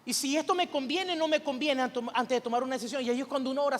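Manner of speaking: ordinary talk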